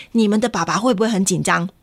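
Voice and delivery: usual speaking voice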